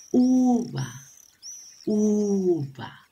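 high, long U in "uva"; stretched first vowel. Shown as a demonstration. Teacher naming pictures that begin with U, stretched "uva". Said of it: "Todos ellos comienzan con la vocal".

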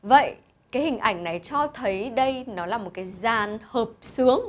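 ordinary talk

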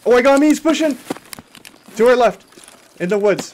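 loud high combat callout; grenade warning yell. Real, not acted. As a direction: yell the enemy position, urgent. Callout of enemy pushing.

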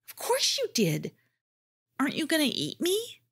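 a hint of a timid small creature. A narrator's reading. Jardad voiced as character.

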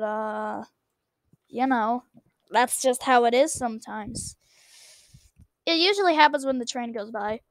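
ordinary delivery

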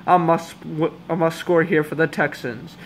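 usual speaking voice

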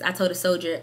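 normal talking voice

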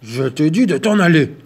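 loud gruff growl; angry bear. Acted. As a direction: order the mouse out angrily. Bear orders mouse out.

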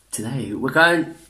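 ordinary delivery

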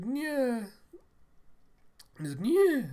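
high, loud imitated "yah"; acted high-pitched whoop; horse-driving cry. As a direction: holler to spook the horses. Driving horses away, voiced.

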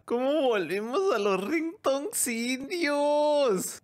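high, slow lament; drawn-out high cry of disbelief; despairing wail. Real, not acted. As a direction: wail it long and high in exasperation. Despair at ringtones returning.

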